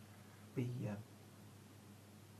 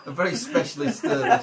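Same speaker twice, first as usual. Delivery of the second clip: laughing; 9 semitones higher, 21 dB louder than usual. Acted. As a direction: laugh through the line. GM laughing in character.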